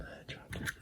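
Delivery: barely audible trailing whisper; fading whisper. Real, not acted. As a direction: let it die into a whisper. Fades the repeat away.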